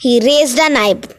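usual speaking voice

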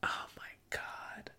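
barely audible whisper. Real, not acted. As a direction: whisper it to yourself, very softly.

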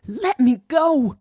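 pinned Natalie shouting to be let go; desperate shout. A narrator's reading. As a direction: scream to be freed. Natalie trapped and struggling.

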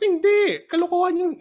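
high-pitched mock protest; indignant retort. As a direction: act out a scoffing denial, pitched right up. Acts out own indignant retort. (kunwaring naiinis na pagtanggi, mataas ang boses)

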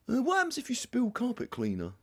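high-pitched put-on voice; imitated quirky woman. Welsh woman coming out with random stuff, mock quirky. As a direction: blurt it out high, as a quirky colleague. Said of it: "when someone just comes out of a thing like".

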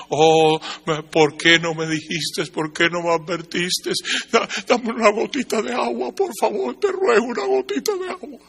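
acted plea climbing to a high, thin cry; desperate pleading. Lost loved one begging for a drop of water, desperate pleading.

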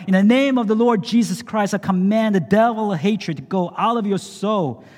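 high, loud exorcising command; commanding cry. Acted. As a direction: order the devil out with full authority. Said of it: "I cried".

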